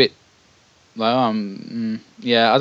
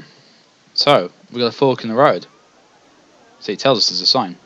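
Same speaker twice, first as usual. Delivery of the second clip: ordinary delivery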